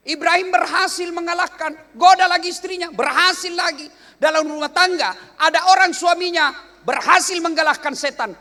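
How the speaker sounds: loud, high-pitched sermon delivery; forceful preaching. Real, not acted. Preacher on Ibrahim defeating temptation, forceful preaching. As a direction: drive each phrase home to the congregation.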